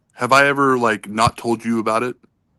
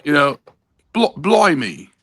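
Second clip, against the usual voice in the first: put-on English accent, pitched up at first; 4 semitones higher, slower than usual. Shown as a demonstration. Joking to the panel in a hammed-up English accent. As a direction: play up a mock English accent. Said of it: "That's my English accent".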